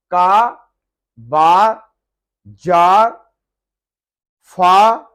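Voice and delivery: का, बा, जार, फा loud with long open vowels; barely touch the R ending का, बा, जार, फा; faint final R. Shown as a demonstration. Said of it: "हल्का सा आर प्रोनाउंस करेंगे". (का, बा, जार, फा में हल्का सा आर)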